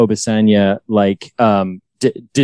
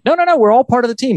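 bubbly insistence; 14 semitones higher, faster than usual. Retold or imitated. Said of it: "he's like".

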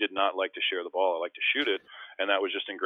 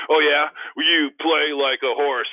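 loud, higher, slow mocking retort; mock comeback; 8 semitones higher, 10 dB louder, slower than usual. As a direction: fire back a playful insult. Trash talk retort on court.

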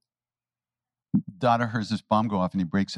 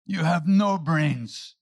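higher and slow teasing; playful taunt; 7 semitones higher, much slower than usual. Retold or imitated. Maharaji slowly teasing Dada about having no brains. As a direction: tease him slowly. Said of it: "looks at Dada and says".

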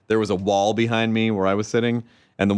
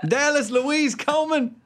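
Southern mom. Acted. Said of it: "The Southern accent seems to be".

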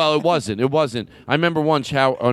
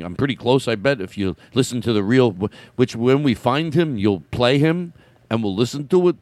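ordinary delivery

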